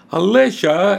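high, loud call of her name; exclaiming. Acted. Girl calling out to her friend in alarm. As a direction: call out sharply to stop her. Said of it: "exclaimed Kayla".

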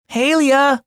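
high, loud call across the house. Acted. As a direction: call your sister to come back. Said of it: "he called".

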